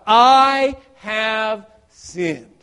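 very slow, weighty I have sinned; slow, solemn admission; slow confession. Acted. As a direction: draw out each word of the confession. Son rehearsing confession.

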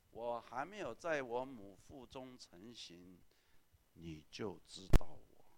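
slow solemn reading of the psalm; scripture reading. Acted. Pastor reading Psalm 139 to the church, slow and solemn. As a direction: read it slowly, weighty and reverent. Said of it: "诗篇一百三十九篇说".

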